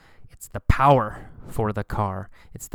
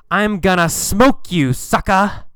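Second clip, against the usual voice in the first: trash talk; 6 semitones higher, 5 dB louder than usual. Acted. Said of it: "this is slang".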